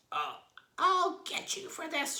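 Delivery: very high, loud hag's threat; furious shout. Acted. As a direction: yell the threat in a rage. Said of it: "shouted Missus Twit".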